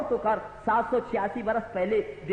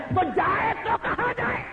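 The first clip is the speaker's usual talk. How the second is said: rhetorical shout; 11 semitones higher than usual. Acted. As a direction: throw the question up in a shout. Punchline of mocking list.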